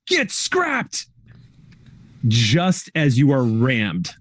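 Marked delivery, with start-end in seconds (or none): 0.1-1.1 shrill-pitched villain yelling as it rams; attacking robot's taunt. Acted. Game master voices a car ramming Sleeper, high taunting cry. Attacking car's shout heard by player.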